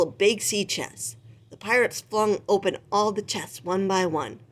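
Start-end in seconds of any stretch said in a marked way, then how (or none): none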